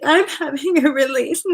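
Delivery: high, loud mock gush. Imitating a man mocking her emotional share, high and loud. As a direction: tease the gushing tone. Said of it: "be like".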